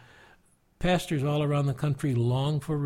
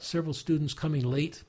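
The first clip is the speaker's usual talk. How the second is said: ordinary talk, nothing marked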